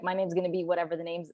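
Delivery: normal talking voice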